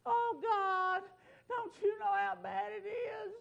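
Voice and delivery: high, whiny, self-pitying plea; mock whining prayer. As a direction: ham up a moaning complainer. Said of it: "That's not doing what he did".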